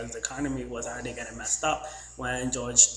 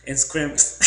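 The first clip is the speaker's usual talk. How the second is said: Southern word; 6 dB louder than usual. Retold or imitated. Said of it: "scrape and scream".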